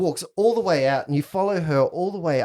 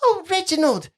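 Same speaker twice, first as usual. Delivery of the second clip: lightly voiced prim girl, higher start; haughty young hostess; 8 semitones higher than usual. Girl inviting gardener to tea, prim. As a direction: play the gracious little lady.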